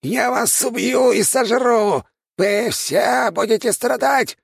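high, slow, squeaky monster threat; squeaky rat king. Acted. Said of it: "пропищал король крыс".

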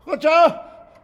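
loud call down the hall. Acted. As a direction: shout a name across the house. Calling servant across house.

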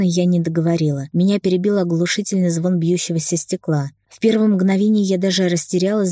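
ordinary delivery

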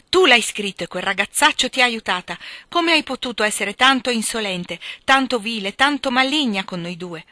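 ireful, forceful scolding; angry accusation. A narrator's reading. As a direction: lash out at the culprit in anger. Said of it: "Con gli occhi fiammanti d'ira".

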